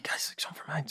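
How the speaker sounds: whispered under the breath, quick and very soft; hushed aside. Retold or imitated. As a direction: mutter it in a whisper while piecing it together. Whispered aside during table read.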